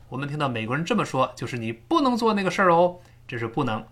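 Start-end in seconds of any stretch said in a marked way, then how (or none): 1.6-3.0 不能 raised high in pitch; emphatic 不能. Shown as a demonstration. Teacher translating the negative, 不能 stressed high. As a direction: put strong weight on 不能. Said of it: "它一定要强调".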